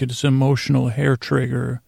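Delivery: ordinary delivery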